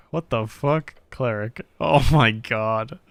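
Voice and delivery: usual speaking voice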